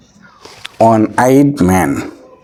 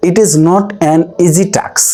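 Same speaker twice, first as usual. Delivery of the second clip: reads the English sentence very slowly and a bit higher; slow example reading; 4 semitones higher, much slower than usual. Shown as a demonstration. Teacher reading the next example slowly to students. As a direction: lay each word out slowly for the class. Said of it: "এবার আসে".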